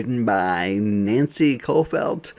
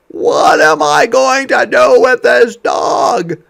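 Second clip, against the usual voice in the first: much higher and louder, fed-up mother; exasperated mom; 14 semitones higher, 13 dB louder than usual. Acted. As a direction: throw up your hands as the mom. Mom voiced in story.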